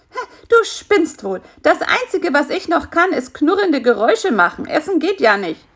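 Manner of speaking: high, indignant fly voice; reproachful. Acted. Hungry fly answers spider's complaint about the noise, reproachful. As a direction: throw it back as a reproach. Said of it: "sagte Bisi vorwurfsvoll".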